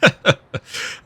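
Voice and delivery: short chuckle before teasing; a quick fond laugh at her; amused laugh. Acted. Partner laughing fondly at Elena's eagerness, short chuckle.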